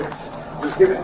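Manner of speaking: high, slow, breathy whisper of the order; whispered echo. Pretend. Reactor echoing the cop's order, in a high slow whisper.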